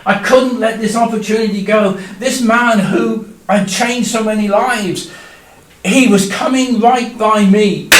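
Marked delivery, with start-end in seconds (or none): none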